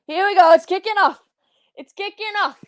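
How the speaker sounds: high, loud fan yell; real live excitement; excited shout. Real, not acted. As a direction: hype it as the brawl starts. Fight breaking out on screen.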